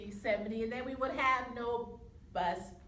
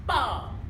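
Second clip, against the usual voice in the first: sudden booming "bong"; one punchy, explosive bang; imitated bomb blast; 3 semitones higher, 7 dB louder than usual. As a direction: make the explosion with your voice.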